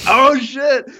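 high, loud burst of glee; excited oh shit. Retold or imitated. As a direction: yelp it like a gambler on a roll. Doubling down on twelve, hyped.

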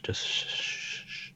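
soft, whispered shh; drawn-out shushing. Real, not acted. Hushing co-host over what to watch, whispered shushing. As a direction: hush them with long, slow shushes.

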